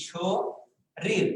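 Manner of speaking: শরীর said slowly and carefully; drawn out. Shown as a demonstration. Teacher spelling out শরীর for students, slowly. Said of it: "যদি আমরা এটা লিখি".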